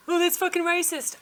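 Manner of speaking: high, loud mocking "Oh"; mock accusation. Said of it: "before you get all".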